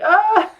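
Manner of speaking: loud high-pitched yelp of panic; startled shriek. Retold or imitated. Reacting to ruining the sketch, high panicked shriek. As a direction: shriek as the drawing goes wrong. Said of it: "I freaked out. I was like".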